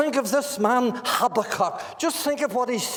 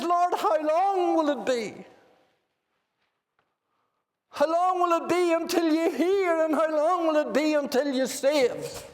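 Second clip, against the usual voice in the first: anguished cry; 7 semitones higher than usual. Retold or imitated. Habakkuk cries to the almighty God, high anguished plea. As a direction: lift the plea up high, stretching each how long.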